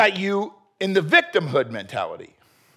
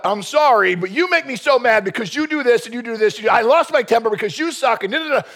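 raised, defensive mock apology; mock excuse-laden apology; 5 semitones higher, 4 dB louder than usual. Preacher imitates someone apologizing with excuses, mock defensive. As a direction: pile up excuses in a higher voice.

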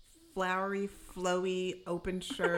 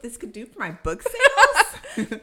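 loud, high imitation of a thrilled schemer; mock pumped, much louder and higher; 6 semitones higher, 12 dB louder than usual. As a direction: laugh through a hammed-up burst of excitement. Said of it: "She's pumped about it".

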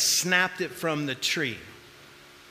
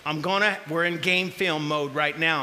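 ordinary talk, nothing marked

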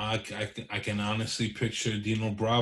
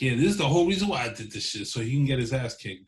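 fast mock quote; 2 semitones higher, faster than usual.

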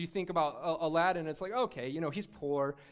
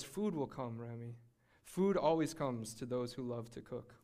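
gentle reassurance; 5 semitones lower, 5 dB quieter, slower than usual. Retold or imitated. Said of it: "And Gusteau says".

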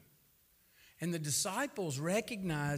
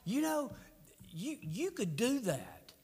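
frustrated, higher, fussing complaint; fussing at God; 6 semitones higher than usual. Retold or imitated. Praying man fusses at God over the boy's death, frustrated and higher. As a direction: vent hurt and anger at God. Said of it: "I'm like".

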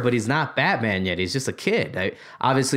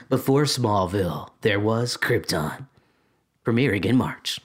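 promo voice; 2 semitones lower than usual. Acted. Host improvising a promo for Krypton, announcer voice. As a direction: announce it like a TV promo, measured and weighty. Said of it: "There's the promo".

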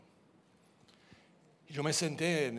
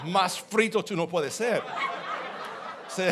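louder, higher, laughing through the line; 8 semitones higher, 8 dB louder than usual. Real, not acted. Preacher laughing to the congregation about his fate. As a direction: chuckle at your own bad luck.

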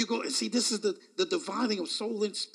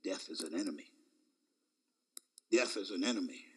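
much lower and softer warning; low inner voice; 10 dB quieter, faster than usual. Retold or imitated. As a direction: say it low and grave. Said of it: "he said".

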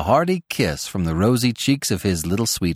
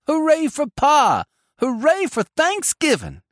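put-on high-pitched boys' hurrah; roared cheer. A narrator's reading. Small boys greet Pa's return, high roaring cheer. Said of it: "the small boys roared".

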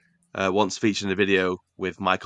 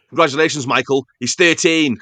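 sarcastic, loud and higher, mock-congratulating; annoyed retort; 6 semitones higher, 8 dB louder than usual. Goalkeeper coach to a gloating striker, loud sarcastic annoyance. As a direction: snap it back like a fed-up coach. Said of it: "He gets really annoyed".

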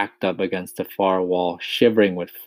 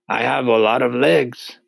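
proud boast, higher and louder; proud centipede; 5 semitones higher, 6 dB louder than usual. A narrator's reading. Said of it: "the centipede answered proudly".

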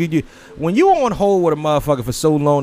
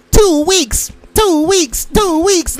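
high stuck repeating robot voice; malfunctioning robot; 13 semitones higher, much slower than usual. Retold or imitated. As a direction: glitch and repeat like a broken machine. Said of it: "She was like".

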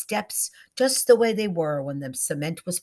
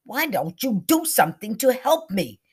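raised, higher-pitched plea; crying out; 6 semitones higher, 6 dB louder than usual. A narrator's reading. One ghostly voice below to his partner, crying out. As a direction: cry out for help in complaint. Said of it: "and one cried".

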